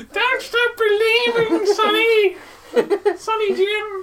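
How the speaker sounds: very high slow witch croon with laughs; creepy witch voice. Pretend. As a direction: sing it like an old witch. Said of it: "You sound like a creepy witch".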